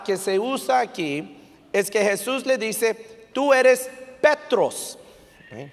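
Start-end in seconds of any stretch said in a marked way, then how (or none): 3.3-5.0 TÚ ERES raised, then a pause before Petros; deliberate, higher-pitched "Tú eres... Petros"; slow emphatic quote. Retold or imitated. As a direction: land "Petros" slowly, falling at the end. Preacher slowly quoting key Greek word.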